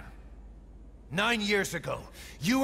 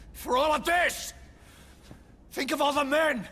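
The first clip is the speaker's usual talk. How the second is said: furious shout. Acted. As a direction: rage at the traitor in front of the men. Man raging at the traitor.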